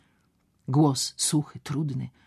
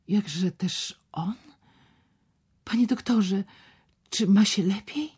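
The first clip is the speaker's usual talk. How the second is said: anxious whisper; 5 semitones higher than usual. Acted. Anxious girl asks about patient.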